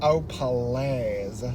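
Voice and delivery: put-on low, drawn-out Valley Girl put-down. Shown as a demonstration. Friend begs for the Valley Girl voice, Valley Girl. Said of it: "Do your Valley Girl".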